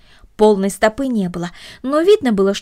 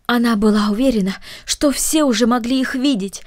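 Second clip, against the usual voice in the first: usual speaking voice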